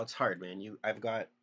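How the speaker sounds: ordinary talk, nothing marked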